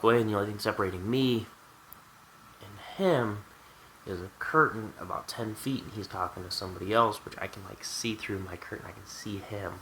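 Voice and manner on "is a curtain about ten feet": usual speaking voice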